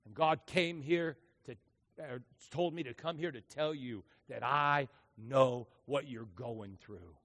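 softly comforting the grieving mother; gentle tender. Retold or imitated. Pastor softening to grieving woman.

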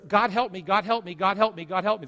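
loud repeated begging; mocking the pleas of the eager crowd; mock pleading. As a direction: beg in a whiny loop. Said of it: "In other words".

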